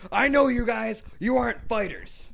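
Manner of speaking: high, loud imitation of a coach; mock coach. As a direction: holler it like a coach rallying the team. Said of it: "Their coach was like".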